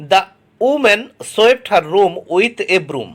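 very slow, word by word; slow dictation reading. Shown as a demonstration. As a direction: read it out slowly for the class to copy. Teacher reads example sentence aloud. (ধীরে ধীরে শ্রুতলিখনের মতো পড়া)

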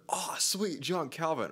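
high, bright excited delight at the name; excited fan reaction. Pretend. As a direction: cheer the name like a happy fan. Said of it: "your response is either".

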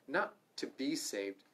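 ordinary talk

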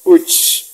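Chuch with a popped first ch, then a tiny stop; glottalized CH. Shown as a demonstration. Teacher repeats the Kaqchikel word, Chuch with stopped, popped onset. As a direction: stop the sound between ch and uch in Chuch. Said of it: "es como parar el sonido".

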